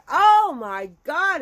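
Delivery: drawn-out, high, loud exclamation; imitated dismayed outcry. Husband as everybody laughs at his name, loud dismay. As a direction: wail it slowly and loudly.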